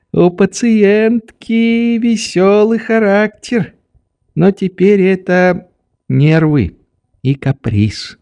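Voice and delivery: put-on high old man's aside, not truly whispered; serious confiding doctor. A narrator's reading. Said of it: "прошептал мне доктор с самым серьёзным видом".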